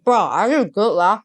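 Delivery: put-on loud Malaysian-English accent, lah at the end. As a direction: Malaysian accent, playful and teasing. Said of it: "you can stop the Malaysian accent".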